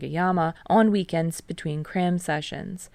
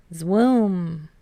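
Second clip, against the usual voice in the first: grave. A narrator's reading. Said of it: "Tadashi agrees gravely".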